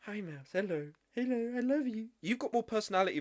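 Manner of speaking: soft cooing greeting to a pet; talking to the dog. Real, not acted. Owner greeting her dog Mouse, soft doting coo. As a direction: coo softly to your puppy.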